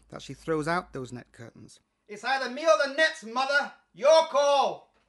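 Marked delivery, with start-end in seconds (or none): 2.1-4.9 high, loud ultimatum yell; shouting at mother. Pretend. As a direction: throw it at mother as a final ultimatum. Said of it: "I'll say".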